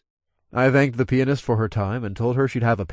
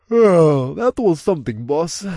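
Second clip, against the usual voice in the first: higher voice opening on a loud yawn; 5 semitones higher, 7 dB louder, slower than usual. A narrator's reading. Tony to the boss after closing, yawning. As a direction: yawn out the first word, then talk on. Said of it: "Tony yawned".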